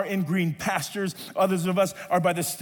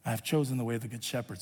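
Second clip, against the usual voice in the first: lower, softer and fast quoted line; quiet quote; 8 semitones lower, 6 dB quieter, faster than usual. Acted. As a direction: toss the psalm quote off softly and quickly. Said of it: "says the psalmist".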